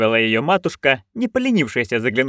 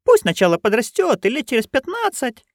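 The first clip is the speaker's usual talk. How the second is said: put-on higher, eager old crone; Nanny Ogg voice; 13 semitones higher than usual. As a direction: pitch up into a gleeful old busybody. (высокий азартный голос старой ведьмы)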